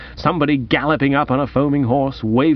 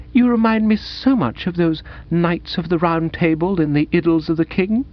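high, earnest romantic heroine; soulful romantic young woman; 4 semitones higher than usual. Acted. Woman likening Bertie to Arthurian knights, high and soulful. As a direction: voice a dreamy admirer, pitched up. Voices Madeline in dialogue.

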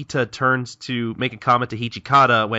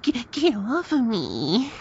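whiny sad; 13 semitones higher, much slower than usual. Retold or imitated. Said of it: "I love how sad she looks".

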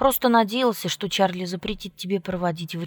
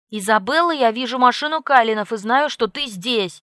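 loud furious yell; enraged call; 4 semitones higher, 6 dB louder than usual. A narrator's reading. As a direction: yell with fatherly fury. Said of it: "взбешённый голос Чарли".

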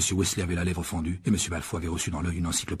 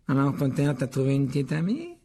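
slightly raised, teasing sneer; mocking irony; 4 semitones higher than usual. A narrator's reading. Malfoy needles Harry about Ginny, mocking. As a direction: tease a rival with mock interest. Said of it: "ironisa Malfoy".

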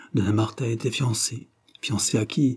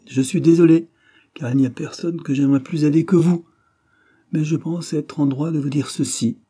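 higher, louder voice for the elated promoter; excitable businessman; 7 semitones higher, 5 dB louder than usual. A narrator's reading. Pollack refuses Drouce a tip, excitedly. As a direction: declare it high and emphatically.

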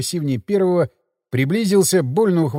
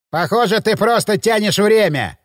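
loud, higher, unkind suspicion; menacing; 6 semitones higher, 4 dB louder than usual. A narrator's reading. Thug menacingly accuses the captive of stalling. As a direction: press him, you don't buy it. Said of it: "недобро проговорил плечистый".

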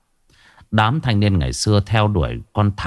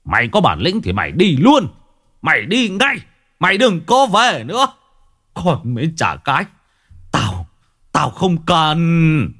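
angry father shouting after his son; yelling after him; 8 semitones higher than usual. Acted. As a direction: hurl it at his back as he leaves. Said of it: "còn chửi vọng".

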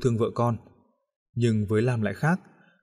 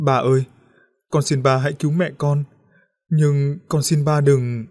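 put-on kneeling girl begs her father through tears; sobbing plea; 3 semitones higher, 5 dB louder than usual. A narrator's reading. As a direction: beg through sobs, dragging the last words. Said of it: "khóc ròng".

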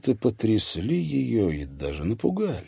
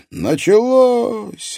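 loud drawn-out cry from a crowd; shout; 8 dB louder, much slower than usual. A narrator's reading. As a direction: call it out to the hall.

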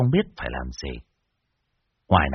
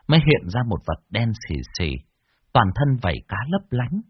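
ordinary delivery, nothing marked

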